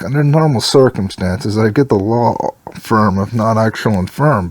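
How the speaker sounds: normal talking voice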